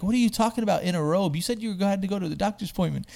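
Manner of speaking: imitating someone upset, rapid-fire; flipping out. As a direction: rattle it off as an incredulous retold outburst. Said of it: "Corey is flipping out".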